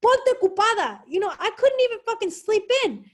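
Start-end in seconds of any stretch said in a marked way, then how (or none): 0.0-1.0 higher-pitched retold motherly orders; lightly voiced nagging mother; imitating mom. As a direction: push out mom's commands, raised and emphatic. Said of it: "she would just be like".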